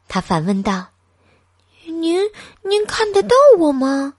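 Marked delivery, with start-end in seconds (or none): 1.8-4.1 stammering, jumping higher in amazement; can't believe someone sees him; startled. A narrator's reading. Boy asking the old man, astonished. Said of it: "小东尼吃了一惊".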